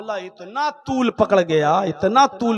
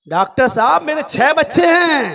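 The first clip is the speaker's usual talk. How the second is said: louder, higher indignant protest; indignant shout; 5 semitones higher, 6 dB louder than usual. Acted. Driver loudly protesting to the doctor. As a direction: insist it loudly.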